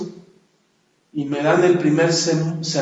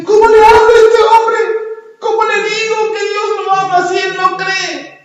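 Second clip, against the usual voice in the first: much higher, louder desperate plea; anguished cry; 6 dB louder than usual. Retold or imitated. Young believer crying out over his unbelieving father, anguished. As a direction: cry out in despair to God.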